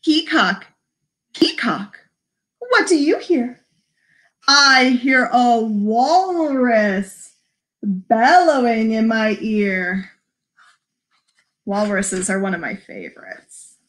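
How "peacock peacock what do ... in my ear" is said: slow, lilting story reading; picture-book read-aloud. Acted. Librarian reading Polar Bear to children, slow lilting read-aloud. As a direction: read it slowly with singsong emphasis.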